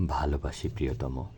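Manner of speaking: low, soft voiced near-whisper; intimate murmur. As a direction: murmur it to your beloved, close to the ear. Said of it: "কানে কানে ফিসফিস".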